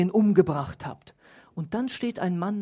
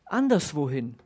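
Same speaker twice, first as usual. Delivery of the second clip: normal talking voice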